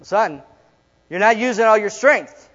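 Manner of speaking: father urging his son; insistent father. Retold or imitated. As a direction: press the point like a patient dad. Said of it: "His dad's like".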